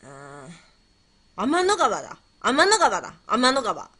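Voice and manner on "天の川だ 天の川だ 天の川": excited realization. Real, not acted. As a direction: blurt it out as it comes to you. Suddenly hits on the answer. (急にひらめいて興奮した声)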